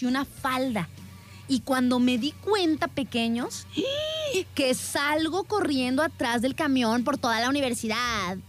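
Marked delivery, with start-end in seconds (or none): 3.7-4.5 high sudden gasp of shock; sharp gasp. Retold or imitated. Recalling the moment she realized the laptop was gone, a high gasp. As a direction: gasp as it hits you.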